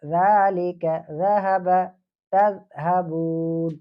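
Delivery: ذالک ذہب تذہبون slow, with a clear ذ; teacher drilling the letter in words; slow recitation. Shown as a demonstration. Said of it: "اسی طریقے سے".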